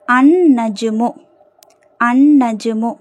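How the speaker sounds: "அந்நஜ்மு" with a long doubled N and no L; teacher's careful model of "அந்நஜ்மு", humming double N; lam silent. Shown as a demonstration. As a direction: hold the N in "அந்நஜ்மு", skipping the L. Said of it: "லாம் உச்சரிக்கப்படலை".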